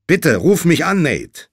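loud, high insistent plea; urgent pleading. A narrator's reading. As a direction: beg loudly down the phone. Josh begs for callback.

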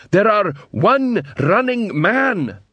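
slow, high, dim troll counting bricks. A narrator's reading.

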